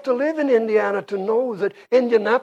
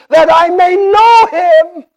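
high, loud cry of longing; ringing, passionate quote from the pulpit; impassioned exclamation; 11 semitones higher, 10 dB louder than usual. Retold or imitated. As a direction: exclaim it as a heartfelt life's goal. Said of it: "Paul exclaimed".

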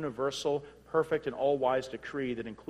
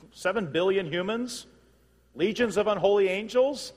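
raised, measured pulpit voice building to a louder peak; emphatic preaching; 5 semitones higher, 4 dB louder than usual. Real, not acted. Preacher weighing human armies against God, emphatic preaching. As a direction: preach it with mounting incredulous force.

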